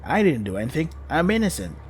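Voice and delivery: usual speaking voice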